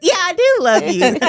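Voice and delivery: high, giggly reassurance; laughing affection. Retold or imitated. Mom answering her son's guessing game in the car, laughing affection. Retells playful exchange with child, laughing.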